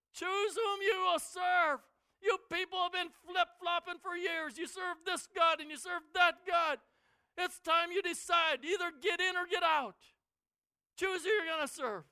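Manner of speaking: high, loud rebuke; stern shout. Retold or imitated. Joshua rebukes the wavering people, loud and stern. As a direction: scold them for wavering, full voice.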